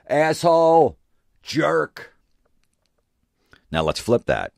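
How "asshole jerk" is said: high shrill heckles; mock crowd jeers. Imitating the audience jeering the husband, high. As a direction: jeer like offended women in the crowd. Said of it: "You hear".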